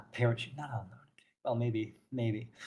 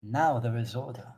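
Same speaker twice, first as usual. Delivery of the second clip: lightly voiced solemn zealot; stern zealot priestess, measured. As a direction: speak as a stern bringer of order, measured. Plays NPC Orthelia.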